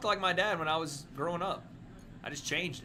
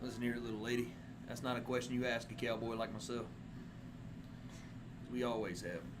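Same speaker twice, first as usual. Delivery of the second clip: cowboy voice; 6 semitones lower, 9 dB quieter than usual. Pretend. Cowboy roleplay courting bit.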